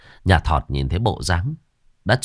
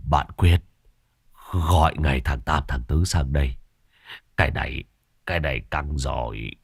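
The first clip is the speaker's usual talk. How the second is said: put-on low, weak, halting voice of a wounded old sorcerer; faint injured old man; 7 semitones lower, 5 dB quieter than usual. Injured sorcerer rasps orders to his friend, low and weak. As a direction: gasp the words out low and weak, nearly fainting. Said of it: "lão nhị ngất lịm".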